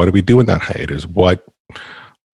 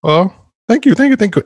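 delighted thanks; 13 semitones higher, 3 dB louder than usual. Real, not acted. Welcomed back, delighted.

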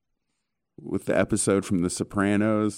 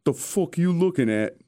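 higher, put-on tough-guy snarl; higher gangster voice, spooked and defensive; Paulie freaking out; 8 semitones higher than usual. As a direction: play the jumpy mobster, rattled and hostile. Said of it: "He's looking around him like".

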